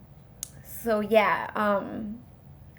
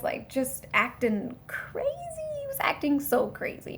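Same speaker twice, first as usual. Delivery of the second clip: ordinary talk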